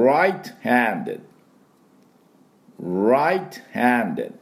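repeat-after-me right-handed; model pronunciation. Shown as a demonstration. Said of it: "私の後について発音いきましょう".

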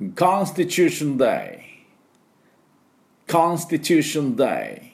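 Constitution Day slow and high, each sound clear; repeat-after-me model. Shown as a demonstration. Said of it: "私の後について発音いきましょう".

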